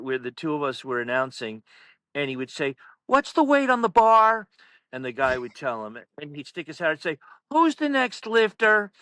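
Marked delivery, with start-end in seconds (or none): 3.1-4.5 loud, high mock coach's call. Coach sticking his head out at the announcers, calling out. 7.5-8.9 high, slow mock call. Coach interrupting announcers through the curtain, calling out.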